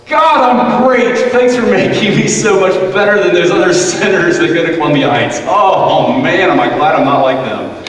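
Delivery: loud, high, smug Pharisee prayer; hammed-up boastful prayer. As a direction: brag to God like a show-off. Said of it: "Was he like this?".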